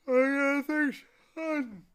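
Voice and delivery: high, slow sleepy creature line; lazy creature voice. Acted. Lethargarian boasting to Milo, high and slow.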